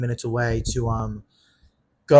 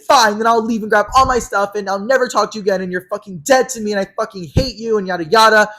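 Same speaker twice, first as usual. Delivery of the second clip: high, loud run-on rant; imitated furious tirade; 8 semitones higher, 7 dB louder than usual. Friend cutting him off for good, furious. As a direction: act out a friend storming off in a huff.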